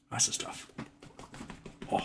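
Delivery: breathy whisper to a pet. Real, not acted. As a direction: whisper it softly. Whisper to cat.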